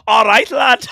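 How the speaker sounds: loud, high Scouse greeting through laughter; mock Scouse. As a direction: shout a hammed-up Scouse hello.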